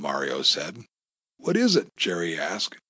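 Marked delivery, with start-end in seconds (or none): 1.4-1.9 high-pitched, curious question; curious despite fear. A narrator's reading. Captive asks about the buried concrete, curious. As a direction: ask with curiosity winning over fear. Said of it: "curiosity overcoming his fear".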